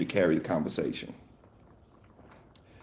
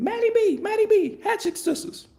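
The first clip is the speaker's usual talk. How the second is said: put-on high squealing kid voice; excited kid voice. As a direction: squeal the names like a young fan. Kids naming pop stars.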